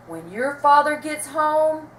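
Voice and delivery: slow, loud threatening cry. A narrator's reading. Mother threatening her children, loud and slow. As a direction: draw it out as a warning. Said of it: "cried Sarah".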